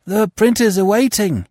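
high, loud calling voice; messenger. A narrator's reading. Messenger boy calls to the editor from outside, calling out. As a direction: call out urgently through a closed door. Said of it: "said the voice of the messenger".

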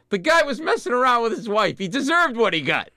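put-on high, indignant old-woman voice; feisty old Italian woman. Little gray-haired Italian woman defending the mayor to interviewers, high indignant outburst. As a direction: defend him loudly as the old local woman. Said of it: "this little gray-haired Italian woman".

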